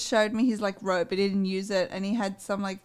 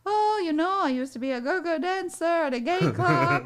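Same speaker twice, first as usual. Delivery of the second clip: a light, higher put-on Italian accent; hint of an Italian accent, pitched up; 6 semitones higher than usual. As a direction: lightly voice the Italian guy bragging. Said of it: "I love the Italian accent".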